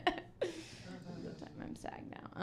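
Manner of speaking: unvoiced quick whisper to the crowd; whispered brag, breathless and hushed; whispered aside. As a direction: murmur it as a secret aside.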